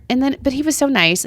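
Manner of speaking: ordinary talk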